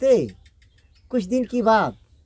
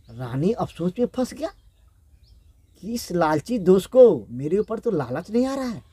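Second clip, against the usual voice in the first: ordinary delivery, nothing marked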